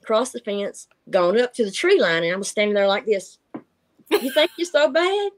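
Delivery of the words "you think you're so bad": high, taunting dare; wife daring husband after a quarrel; mock challenge. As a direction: dare him to come fight.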